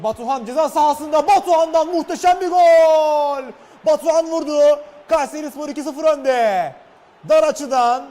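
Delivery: high, loud goal celebration; screaming football commentator. Real, not acted. Live football goal call. (coşkuyla bağıran spiker)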